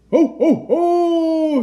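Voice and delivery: high, booming ho-ho, last one held long; loud shout. A narrator's reading. Santa flying into the sky, shouting loudly. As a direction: shout a big jolly Santa call. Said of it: "shouted Santa loudly".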